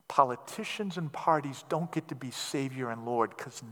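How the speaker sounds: quiet heartfelt sermon close; gentle soft preaching. Acted. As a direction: draw the congregation in with tender conviction. Soft intimate closing of sermon.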